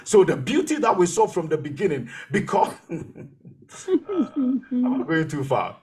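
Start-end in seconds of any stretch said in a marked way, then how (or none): 2.3-5.8 breaking into a chuckle mid-sentence; real amused laughter breaking up the words; laughing. Real, not acted. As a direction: chuckle at yourself for getting carried away. Preacher cracks up at own tangent.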